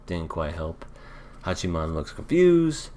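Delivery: normal talking voice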